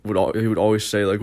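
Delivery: normal talking voice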